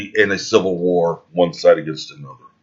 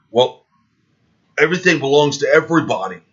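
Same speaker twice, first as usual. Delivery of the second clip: higher, sneering mock voice; mocking quote; 7 semitones higher, 4 dB louder than usual. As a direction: parrot them with scorn. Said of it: "them saying".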